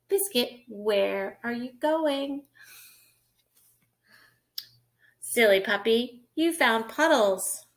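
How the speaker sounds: bright, lilting picture-book read; reading to children. Acted. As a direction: read it like storytime for a toddler.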